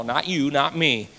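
normal talking voice

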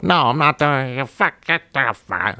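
high, shrill-free mock of a hostile woman, pitched well up; woman brushing off the cop, high mock anger; angry woman's voice.

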